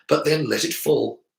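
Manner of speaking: ordinary delivery, nothing marked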